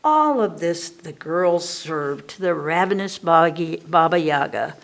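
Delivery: ordinary delivery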